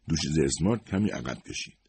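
ordinary delivery

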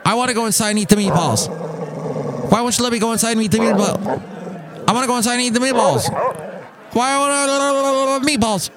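high, rapid whining dog; protesting dog voice. Acted. Boxer dog begging to leave the car, high frantic protest. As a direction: gabble the protest in a high-pitched pet voice. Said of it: "He protested a little bit".

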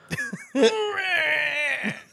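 high screeching laugh-shout; dying giraffe screech. Retold or imitated. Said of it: "a dying giraffe".

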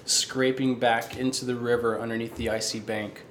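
slow, hushed dark fantasy narration; story narration. Acted. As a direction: draw the players into the eerie scene. Game master scene setting.

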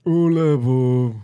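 dopey cartoon voice in Spanish; dopey Patrick Star in Spanish; Patrick Star impression. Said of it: "I can properly impersonate ... Patrick Star".

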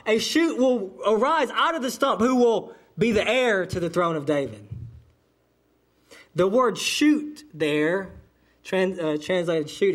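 usual speaking voice

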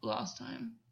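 low, hushed character voice; voice not quite his own. A narrator's reading. Gwen answering August's intimate question, low unsteady-selfed murmur. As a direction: drop deep, as if not quite yourself. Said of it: "voice still not quite his own".

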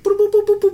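rising boop beeps; boop sound effect. Shown as a demonstration. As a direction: chirp boop boop like a jingle. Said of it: "boop sound effect".

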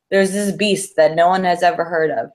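normal talking voice

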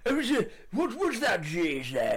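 Charles voice. Retold or imitated. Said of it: "doing this voice".

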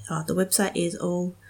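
usual speaking voice

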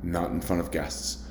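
low, hushed firm rebuke; firm quiet warning. Retold or imitated. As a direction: keep it down, guests are near. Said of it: "And I was like".

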